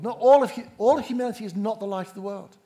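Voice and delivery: "Not all of" jumps high and loud, then races on; a sudden forceful correction, pushed out fast; emphatic loud insistence. Real, not acted. Preacher insisting to the church, loud and emphatic.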